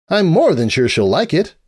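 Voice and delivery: put-on a bright young mare rambling on; cheerful unicorn scholar voice; Twilight voice.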